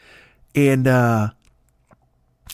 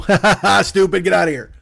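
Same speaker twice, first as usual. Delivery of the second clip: mocking laugh; 3 semitones higher, 4 dB louder than usual. [name] mocks family.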